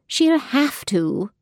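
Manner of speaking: high, slow firm insistence; firm no-nonsense. Acted. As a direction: overrule her flatly. Nurse overrules refusal.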